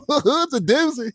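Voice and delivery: put-on Southern accent. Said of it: "doing southern accents".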